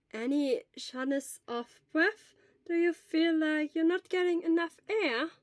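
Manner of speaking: put-on slow, gentle questions from an old nurse; lightly voiced elderly nurse. Elderly school nurse gently questions a feverish boy. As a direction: ask slowly and calmly, like a school nurse.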